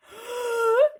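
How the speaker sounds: sharp high frightened gasp; a loud high startled gasp; shocked gasp. Acted. Creatures see cloud man coming.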